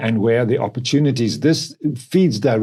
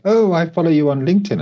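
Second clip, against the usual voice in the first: higher-pitched greeting of a new acquaintance; quoted lady; 7 semitones higher, 4 dB louder than usual. Retold or imitated. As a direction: lightly voice a lady meeting someone. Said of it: "introduced to a lady and she said".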